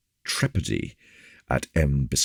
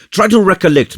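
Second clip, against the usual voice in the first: eager urging; 9 dB louder, faster than usual. Acted. Officer urging Porthos.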